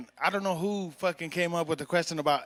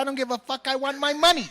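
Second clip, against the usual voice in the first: movie tough-guy demand; 7 semitones higher, 3 dB louder than usual. Acted. Acting out an angry Casino quote.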